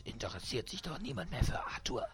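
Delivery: strangled, gasping hiss breaking into high squeals; choked hissing. A narrator's reading. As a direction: force the words out while being throttled, gasping between phrases. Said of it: "Blaurote Atemnot würgt die Stimme, die zischend antwortet".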